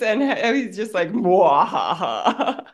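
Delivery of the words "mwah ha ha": low villain laugh; evil Mr. Burns chuckle; mad scientist cackle. Retold or imitated. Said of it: "Mister Burns from The Simpsons".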